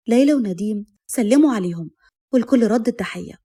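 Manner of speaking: plain reading voice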